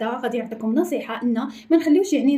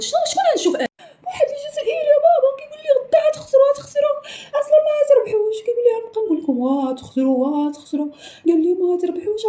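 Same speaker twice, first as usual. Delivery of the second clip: high whiny tearful telling; sobbing complaint; 10 semitones higher than usual. Acted. Crying child telling dad what the Algerian said. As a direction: whimper it to dad.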